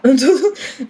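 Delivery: short real laugh; amused laugh. Real, not acted. Vlogger amused by an undeserved grade, short laugh. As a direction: laugh briefly at your own luck.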